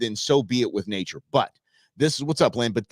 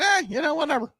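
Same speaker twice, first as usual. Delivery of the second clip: shrugging, much higher put-on voice; lightly voiced couldn't-care-less self; dismissive inner voice. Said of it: "the me and me, like I said, just said".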